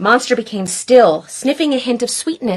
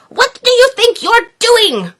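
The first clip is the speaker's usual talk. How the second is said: high indignant cry; 13 semitones higher, 3 dB louder than usual. Acted. Grouch cries out at the monster, high. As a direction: scold the monster. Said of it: "cried Grouch".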